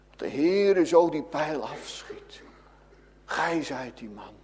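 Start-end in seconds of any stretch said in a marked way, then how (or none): 3.3-4.3 low, soft pronouncement of judgment; hushed verdict. Acted. Voicing God's verdict to the sinner, hushed and low. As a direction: drop the voice for the sentence.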